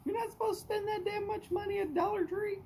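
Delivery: hammed-up high falsetto of an indignant wife; imitating his wife. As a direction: squeak out the wife's outrage at the prices. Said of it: "My wife was like".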